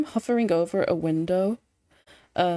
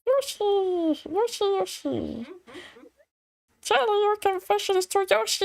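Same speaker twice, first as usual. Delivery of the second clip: very high squeaky Yoshi call; 11 semitones higher than usual. Acted. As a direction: chirp it like the little green dinosaur. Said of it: "get back in contact with my Yoshi voice".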